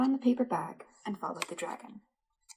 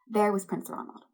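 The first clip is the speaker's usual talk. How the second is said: ordinary delivery, nothing marked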